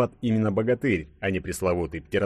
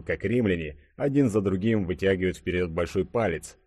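ordinary delivery

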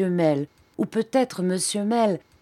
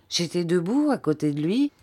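ordinary delivery, nothing marked

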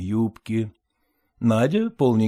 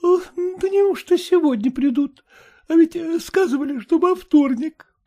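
sleepy voice; 3 dB louder than usual. A narrator's reading. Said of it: "спросила заспанным голосом".

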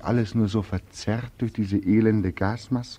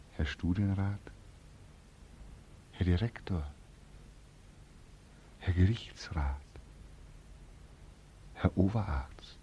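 bitter whisper; 10 dB quieter than usual. Acted. Bitter list of the comfortable.

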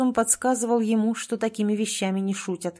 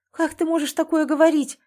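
high, loud cry of horror; horrified cry; 8 semitones higher, 5 dB louder than usual. A narrator's reading. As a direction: cry out in shock and dismay. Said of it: "в ужасе вскричала Аня".

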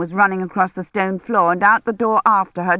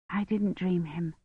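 frightened thought; 12 dB quieter than usual. A narrator's reading. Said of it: "she thought frightenedly".

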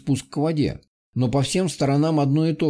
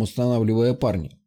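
usual speaking voice

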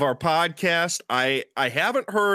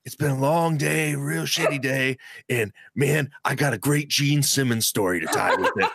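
Blade voice impression. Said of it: "I'll try and do my best Blade voice".

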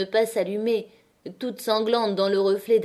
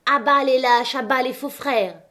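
high, loud hostile mob cry; jeering; 4 semitones higher, 5 dB louder than usual. A narrator's reading. Strikers at miners trying to flee, jeering. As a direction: shout them down in contempt. Crowd hooting the fleeing miners.

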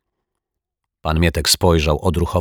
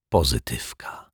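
whisper; 5 semitones lower, 6 dB quieter than usual. A narrator's reading. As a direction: breathe the name like a secret. Dramatic title whisper. (szeptem, tajemniczo)

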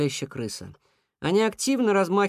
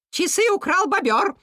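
very high-pitched indignant bird; accusing cuckoo; 4 dB louder than usual. A narrator's reading. Cuckoo accusing the beaver of theft, high outraged cry.

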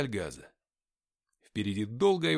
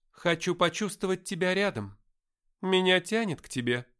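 put-on high, louder young woman character voice; Даша; 6 semitones higher, 6 dB louder than usual. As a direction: lift the pitch and speak out, as a woman in dialogue. Narrator voices Dasha in dialogue. (высокий женский голос персонажа)

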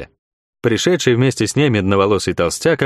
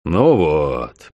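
grumbling; 6 semitones lower than usual. A narrator's reading. Fat man annoyed at the trouble, low grumble. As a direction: lower grumble. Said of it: "проворчал толстяк".

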